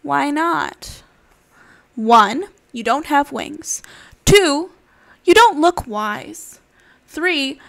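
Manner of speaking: lively storybook voice with animal voices; reading to children. Acted. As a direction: read it like a bedtime picture book. Reads picture book aloud.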